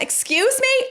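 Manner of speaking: high-pitched, put-on indignation; affronted retort. Telling of confronting muttering housemate, mock affronted. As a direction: replay an offended comeback, pitched right up. Acting out affronted reply to threat.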